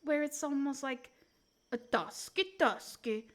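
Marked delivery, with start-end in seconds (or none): 1.7-3.3 slow Nordic-style game voice; Tusk's way of talking. Retold or imitated. Streamer imitating a Dota character, slow mock accent. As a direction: draw it out like Tusk. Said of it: "where they kinda talk like this".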